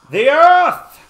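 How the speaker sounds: very loud, high, drawn-out mock cry; dramatic shout. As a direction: bellow it like a hammed-up proclamation. Practises a dramatic shouted line.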